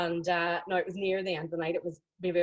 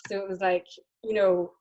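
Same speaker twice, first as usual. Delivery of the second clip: normal talking voice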